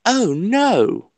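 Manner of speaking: high-pitched exclamation in a put-on voice; Seventh Doctor. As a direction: demonstrate the character's dismay. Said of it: "you do the Seventh Doctor voice".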